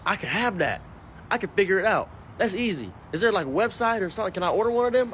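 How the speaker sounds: hammed-up granddad getting keen; eager granddad. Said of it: "He said".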